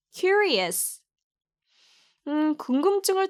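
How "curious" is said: "Curious" with a high, strong "kyu" at the start; stress on CU. Shown as a demonstration. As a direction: punch the first syllable of "Curious", let the rest fall. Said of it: "Q를 좀 강하게 발음하세요". ("Curious"의 '큐'를 높고 강하게)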